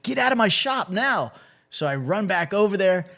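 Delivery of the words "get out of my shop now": retold yell at the rookie. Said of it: "he was yelling at me".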